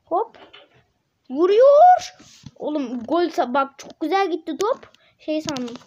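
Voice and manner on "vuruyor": high, loud cry of the shot; excited shout. Real, not acted. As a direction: yell as he shoots. Shot on goal.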